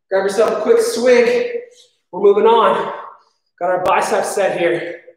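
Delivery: normal talking voice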